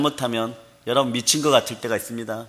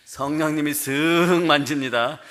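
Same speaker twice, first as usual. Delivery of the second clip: slowly, leaning on 쓱 with a rise; the Spirit's touch told slowly, 쓱 lifted; slow, stressed 쓱; slower than usual. Shown as a demonstration. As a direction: draw out 쓱 gently at full voice. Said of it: "어떻게 한다고요?".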